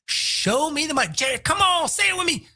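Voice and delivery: high, urging hype voice; mock hyped urging; pumped player. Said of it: "He says".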